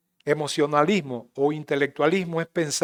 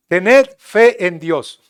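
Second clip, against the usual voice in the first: louder, slowly drawn out; slow solemn stress; 2 semitones higher, 9 dB louder, much slower than usual. Acted. Jesus urges faith, loud and slow. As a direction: weigh each word.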